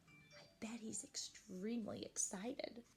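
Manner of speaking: soft whispered aside. Real, not acted. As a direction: whisper like sharing a secret.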